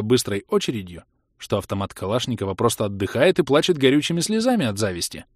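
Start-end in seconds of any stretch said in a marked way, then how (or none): none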